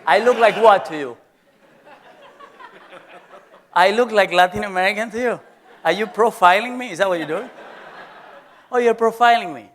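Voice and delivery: hammed-up outrage with a laugh; mock upset. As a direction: fake offense, teasing the class. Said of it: "now I'm getting upset".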